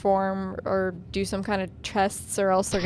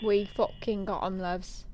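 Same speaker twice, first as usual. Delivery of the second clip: usual speaking voice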